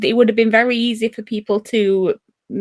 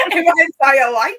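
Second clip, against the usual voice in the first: mock Wigan echo; 3 semitones higher, 9 dB louder than usual. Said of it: "Said life like that".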